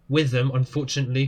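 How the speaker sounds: usual speaking voice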